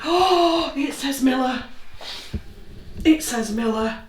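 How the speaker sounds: real excited disbelief; excited gasp. Real, not acted. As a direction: burst out in amazement at the reading. Name appears on the device.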